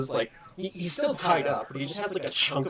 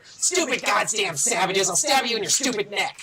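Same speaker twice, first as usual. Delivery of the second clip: snarled threat, higher and louder; angry ambush threat; 7 semitones higher, 4 dB louder than usual. Acted. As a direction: spit the insults at the intruders. Goblin ambush attacks player from shoulders.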